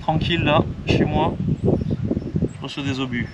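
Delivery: lightly voiced squeaky worm; a hint of a startled little worm. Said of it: "il a dit".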